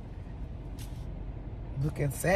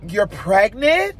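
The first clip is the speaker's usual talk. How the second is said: drawn-out rising question, louder; heightened voice; 2 semitones higher, 6 dB louder, slower than usual. Retold or imitated. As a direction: imitate his heightened, slow questioning reaction. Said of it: "It was, like, heightened".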